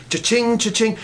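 ringing cha-ching sound effect; imitated cash register. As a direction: mimic coins ringing up in his head. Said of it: "That's all he hears in his head".

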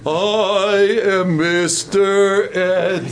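very slow drawn-out talking-horse voice, lightly done; Mister Ed. Retold or imitated. Preacher voicing Balaam's donkey, slow mock talking-horse. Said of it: "this is the donkey talking".

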